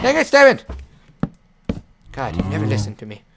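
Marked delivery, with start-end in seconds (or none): 0.0-0.6 high, loud outburst of frustration; frustrated, high-pitched yell; exasperated shout. Real, not acted. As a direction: yell at a pet that won't listen. Frustrated at animal not obeying.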